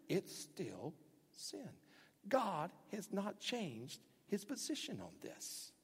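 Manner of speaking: very slow, soft, deliberate; preacher closes the excuses, slow and soft; slow quiet verdict. Acted. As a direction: lay it down slowly and softly.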